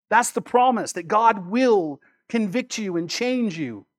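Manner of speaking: ordinary talk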